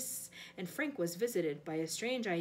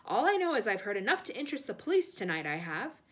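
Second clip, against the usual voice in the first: loud, forceful threats; defiant accusation; 3 semitones higher, 8 dB louder than usual. A narrator's reading. Frank threatening the killer with police, loud defiant accusation. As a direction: accuse boldly, punching it out.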